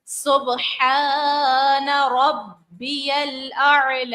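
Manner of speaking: very slow melodic tasbih with stretched lam; slow chanted recitation; tajweed recitation. Shown as a demonstration. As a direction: recite with clear ain and a long lam. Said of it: "عین کو ظاہر کریں گے اور لام کو کھینچیں گے".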